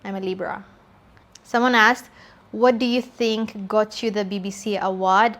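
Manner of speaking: usual speaking voice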